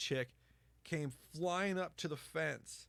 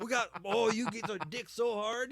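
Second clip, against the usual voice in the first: high, louder sleazy sales pitch; hammed-up vendor; 9 semitones higher, 7 dB louder than usual. Vendor hustling Viagra to the buddy, high sleazy pitch. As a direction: oversell it like a street hustler.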